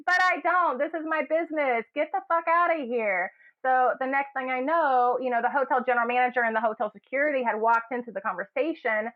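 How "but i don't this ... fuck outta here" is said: high, half-angry snap back; retold retort. New supervisor snapping at the booing crowd, half-angry.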